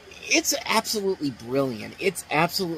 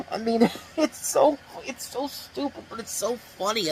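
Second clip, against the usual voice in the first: laughing; 9 semitones higher than usual. Real, not acted. Laughing at the movie scene.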